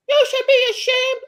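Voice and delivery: high, loud shrill scold; mock scolding lady. Mimicking the Starbucks lady scolding him, high and loud. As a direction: squeal it like a scandalized lady. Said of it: "and she's like".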